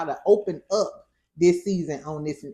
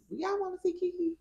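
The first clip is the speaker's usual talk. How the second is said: playful high question; 12 semitones higher, 7 dB quieter, faster than usual. Real, not acted. Asking viewers playfully.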